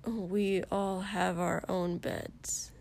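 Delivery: slow, soft, reasonable reply. A narrator's reading. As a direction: state it slowly and reasonably. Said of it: "Shoto said reasonably".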